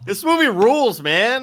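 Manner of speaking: real thrill in the voice; excited. Real, not acted. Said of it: "goosebumps excitement".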